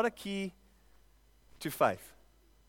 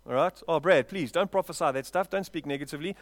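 ordinary delivery

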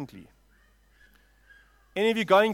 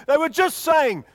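loud, slow outburst imitating the crowd; big raised-voice pulpit exclamation; impassioned preaching; 7 semitones higher, 10 dB louder, slower than usual. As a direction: cry it out in indignant disbelief. Preacher contrasts the crowd's cries.

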